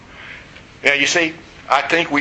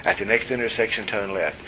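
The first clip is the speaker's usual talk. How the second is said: softer, even sat-nav drone; calm GPS voice; 4 semitones lower, 8 dB quieter than usual. Retold or imitated. Preacher imitating his GPS repeating directions softly. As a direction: repeat it like a patient machine. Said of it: "It's just always".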